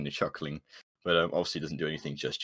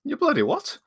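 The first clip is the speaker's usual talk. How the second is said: high-pitched incredulous character voice; indignant, pitched-up confusion; baffled retort; 4 dB louder than usual. Acted. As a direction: react in disbelief to a goblin. Character baffled by goblin's question.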